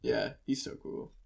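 breathy hushed aside; whisper. Real, not acted. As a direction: whisper it in awe. Whispered aside about the poet.

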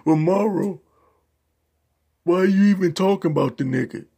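high, loud mock challenge from a critic. Vlogger voicing a critic who questions him, mock challenge. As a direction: throw the critic's question back at yourself. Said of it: "some people are gonna say".

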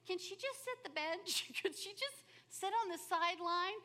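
high mock teammate jeer. Teammates picking sides in P E, high mocking whine. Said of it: "they would have just said".

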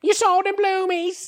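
slow, very high bird squawk; squeaky put-on British parrot. Riffing as a British-taught parrot in a slow high squawk. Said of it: "learn to talk from British people".

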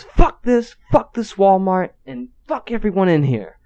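retold furious outburst. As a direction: imitate an irate customer cursing everyone. Customer's final outburst at managers.

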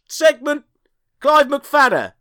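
ordinary talk, nothing marked

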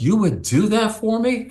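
high, astonished disbelief; amazed question. Retold or imitated. Dolores amazed by the offer.

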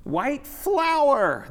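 high, loud, drawn-out holler; gleeful clown shout. A narrator's reading. Clowns join in the marchers' yelling, gleeful high drawn-out shout. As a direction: holler it very high and slow, joining in with glee. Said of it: "the clowns shouted".

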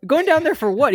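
can't believe what he just heard; incredulous question. Retold or imitated. Narrator asking the colleague who wants to confront the referee, high incredulous ask.

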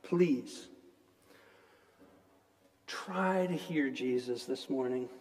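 quiet, earnest plea to the congregation; soft pleading sermon. Acted. Preacher softly pleading with the congregation, quiet and earnest. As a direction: softly urge them to listen.

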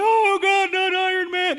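high, loud hammed-up cry of grief; mock weeping wail. Preacher re-enacts weeping at Endgame, loud mock wailing. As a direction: wail it like a heartbroken fan, exaggerated. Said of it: "I wept".

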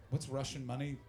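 ordinary talk

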